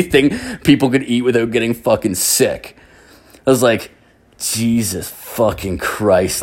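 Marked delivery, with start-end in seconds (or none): 4.4-6.4 slow, drawn-out exasperated oath; slow-motion incredulity at the mess; retold disbelief. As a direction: space out each word, appalled. Appalled at all the inedible food.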